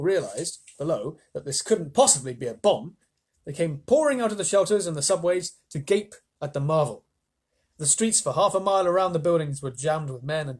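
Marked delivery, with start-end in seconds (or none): none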